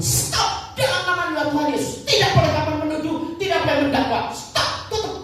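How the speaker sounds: high-pitched, urgent command to a spirit; re-enacted stern rebuke. Preacher forbids spirit to accuse his friend, stern acted command. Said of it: "Langsung saya bilang".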